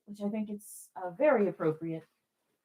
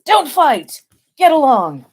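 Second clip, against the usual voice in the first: loud, high mock scolding of the balloons; playful scolding shout; 9 semitones higher, 11 dB louder, slower than usual. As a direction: tell them off like squabbling kids.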